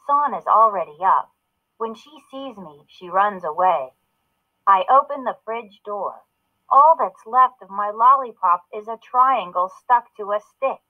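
usual speaking voice

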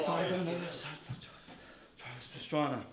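low, soft fumbled repeat; faked choke. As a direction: trail off as if the lines have gone. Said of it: "That ain't a real choke".

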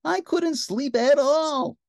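high, loud, drawn-out moan; princess's exaggerated tired moan. A narrator's reading. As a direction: wail it wearily, stretching the words. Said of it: "she moaned".

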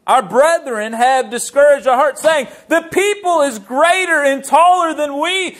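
high, sustained scripture declamation; forceful preaching. Acted. Preacher reading the spies' report of giants, forceful preaching. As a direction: ring out the verse with building force.

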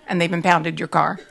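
ordinary delivery

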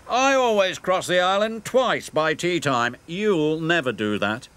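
high, haughty engine boast; sniffy. Acted. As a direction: sniff it out superior. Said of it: "he sniffed".

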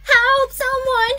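high, drawn-out frightened call; cry for help. Acted. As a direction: call out slowly and high for rescue. Child runs out crying for help.

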